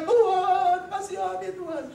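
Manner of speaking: high wailing cry that falls away; imitating a desperate shouted prayer. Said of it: "Dan saya berteriak-teriak".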